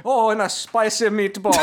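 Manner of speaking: loud, high hammed-up Italian accent; mock Italian. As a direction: play a passionate fake Italian. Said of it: "talking to her in Italian".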